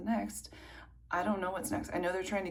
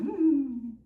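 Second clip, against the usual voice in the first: high doubtful hum; 8 semitones higher, 7 dB louder than usual. Retold or imitated. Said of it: "I'm like".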